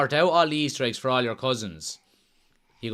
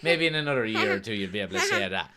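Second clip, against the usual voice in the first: ordinary talk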